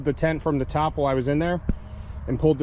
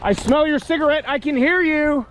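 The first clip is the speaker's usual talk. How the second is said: shouting; 11 semitones higher, 3 dB louder than usual. Real, not acted. Yelling at the lurker.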